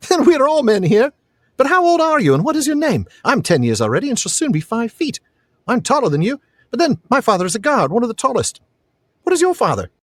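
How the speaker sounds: put-on high, eager boy's voice; very high-pitched boy character; boastful young lad. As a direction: brag and quiz like a ten-year-old. Reads the lad's dialogue.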